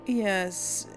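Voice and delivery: usual speaking voice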